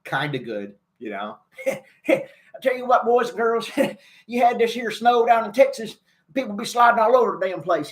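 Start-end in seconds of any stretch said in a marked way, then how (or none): 2.1-7.9 put-on folksy Texan president talking to the kids; George W. Bush impression. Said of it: "impersonation of George W. Bush".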